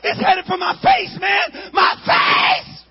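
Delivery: high, loud panicked telling; panicked shouting. Retold or imitated. Boy running from a firework aimed at his face, panicked shouting. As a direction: shout it like you're still running.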